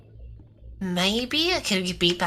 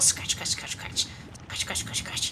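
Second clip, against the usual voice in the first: whispered scrubbing; 7 dB quieter than usual. Pretend. Mock scrubbing in shower.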